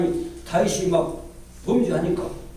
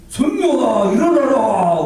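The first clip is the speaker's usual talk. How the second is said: raised, higher wake-up call; calling awake; 3 semitones higher, 3 dB louder, slower than usual. Retold or imitated. God wakes the tired Sunday school teacher, calling out. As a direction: call out to rouse a sleeper. God waking him for church.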